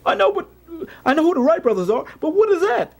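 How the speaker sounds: high-pitched, insistent clown; baffled clown. Acted. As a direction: ask it in a squeaky clown voice. Acting the baffled clown's reply.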